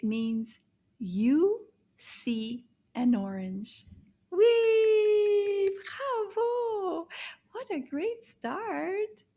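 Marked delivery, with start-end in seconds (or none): 4.3-5.7 high, loud, sustained whee; gleeful cheer. Acted. Teacher cheering the children on, high and loud. 6.3-9.2 excited praise. Acted. Praising young learners.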